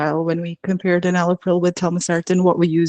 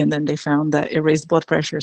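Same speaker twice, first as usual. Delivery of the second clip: usual speaking voice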